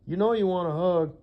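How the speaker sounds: singsong tease, coaxing her in; teasing coax. Pretend. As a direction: egg her on into a hug, big and bright.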